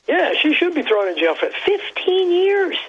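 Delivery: high, mocking voice of a smug voter; mock the poll crowd's righteous verdict; high sarcastic imitation of a self-satisfied citizen; sarcastic mock agreement.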